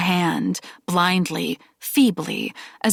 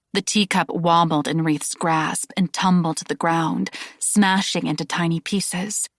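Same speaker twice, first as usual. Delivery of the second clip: plain reading voice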